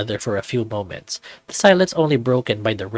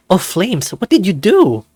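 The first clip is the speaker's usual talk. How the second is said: higher and louder, yelping from sudden pain; pained outcry; 7 semitones higher, 5 dB louder than usual. Acted. Janken to the panther as ink burns him, pained louder outcry. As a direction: cry out as the ink burns your hand.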